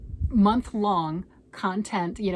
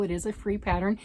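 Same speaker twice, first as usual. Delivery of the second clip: ordinary talk, nothing marked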